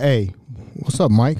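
low, heavy tough guy greeting; deep big guy voice. Retold or imitated. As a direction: voice him low and gruff.

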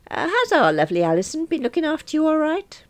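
high genial man voice; breezy solicitor; lightly voiced young boss. Young Davis greeting client.

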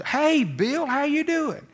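mock-hearty phone greeting; cheery greeting. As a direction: greet him like an old buddy, bright and warm. Big shot greets caller cheerfully.

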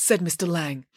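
plain reading voice

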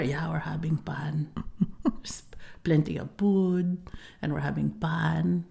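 soft, slow whispery dad; imitating dad. Daughter imitating her dad saying they're having fun, soft and slow. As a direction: murmur it slowly like her quiet dad. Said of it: "I'm like".

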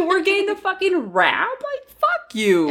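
high, laughing complaint, slowing at the end; laughing indignation. Real, not acted. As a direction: laugh it out in exasperation.

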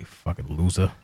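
ordinary talk

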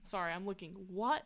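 usual speaking voice

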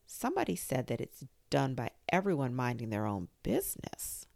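ordinary delivery, nothing marked

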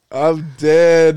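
ordinary talk

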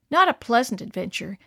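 usual speaking voice

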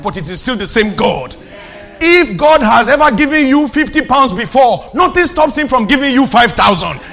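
fervent, high-pitched, hammering sermon climax; full-force preaching. Real, not acted. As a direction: drive each promise home to the congregation, rising to a shout.

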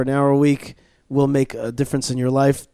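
normal talking voice

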